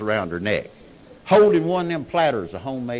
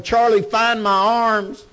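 wounded man hollering; 8 semitones higher, 7 dB louder than usual. Retold or imitated. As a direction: beg for your arms at the top of your lungs. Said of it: "that's hollering".

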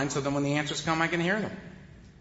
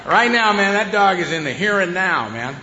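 normal talking voice

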